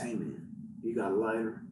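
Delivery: low, mumbly junkie asking for a light; crackhead voice. Retold or imitated. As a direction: rasp it out like a strung-out addict. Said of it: "that crackhead voice".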